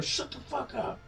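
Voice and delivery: high, soft mock shout; mock yell. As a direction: imitate an angry retort without the volume. Retold retort with a backhand.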